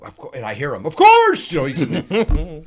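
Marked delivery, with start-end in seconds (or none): 0.9-1.5 high, loud, exuberant call; hearty, acted-out shout; booming welcome. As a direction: holler it heartily from another room. Mel Brooks's reply, overheard offstage.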